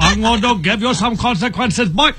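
high-pitched, hammed-up Indian-accented dad; mock Indian accent. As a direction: scold the kids in a high, put-on Indian accent. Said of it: "is an Indian accent".

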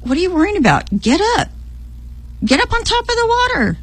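high-pitched, incredulous chiding, stretched out at the end; exasperated chiding. Retold or imitated. God telling the doubting swimmer to rise, exasperated chiding.

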